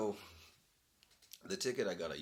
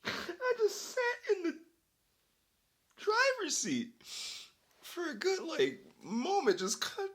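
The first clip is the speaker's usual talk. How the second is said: high, cracking voice laughing to tears; can barely get the words out laughing; helpless laughter; 8 dB louder than usual. Real, not acted. Helpless laughter telling the story.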